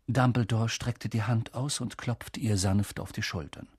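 ordinary delivery

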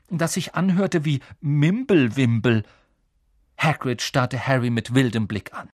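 ordinary delivery

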